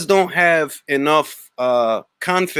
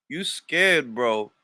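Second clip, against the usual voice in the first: ordinary talk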